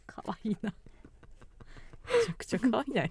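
hushed amused whisper. Real, not acted. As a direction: whisper it to yourself, charmed. Amused aside about the listener. (ひそひそ声で)

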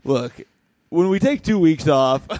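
ordinary delivery

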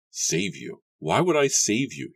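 coldly incredulous, rising sharply on the second question; cold demand. A narrator's reading. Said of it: "his voice as cold as his eyes".

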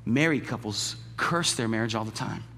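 ordinary talk, nothing marked

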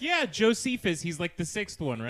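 high, loud mock agreement; mock confident. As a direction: chirp it out brightly. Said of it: "And I'm like".

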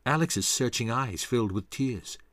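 usual speaking voice

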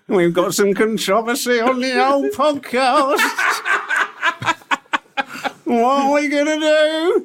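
hammed-up panic, climbing to a very high, slow wail; scared voice. Podcast host frets over being cancelled, mock panic. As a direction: wail it high and drawn out in pretend terror. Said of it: "That's your scared voice".

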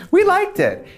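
squeaky high-pitched imitation; mocking the Klarna people in a squeaky voice; high mock reply. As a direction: quote them in a tiny high voice. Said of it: "And they were like".

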